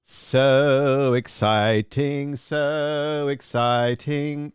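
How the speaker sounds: very slow, stretched words; drawn out. Pretend. Mock-dreamy repeat to the chat, very slow. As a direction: savour each syllable slowly.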